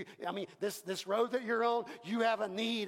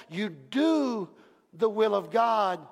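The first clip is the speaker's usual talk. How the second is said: normal talking voice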